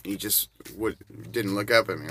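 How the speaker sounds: normal talking voice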